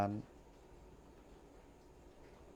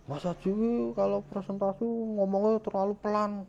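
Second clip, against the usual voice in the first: much higher voiced complaining listener; imitating critic; 11 semitones higher, 4 dB louder than usual. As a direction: mimic a picky colleague's complaint. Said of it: "Nanti kalau kritik, dikritik".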